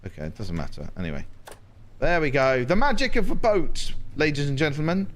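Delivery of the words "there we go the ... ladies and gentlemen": loud, high showman flourish; a grand reveal to the audience; showman announcement. Pretend. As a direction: present it like a circus ringmaster. Showman presenting his boat.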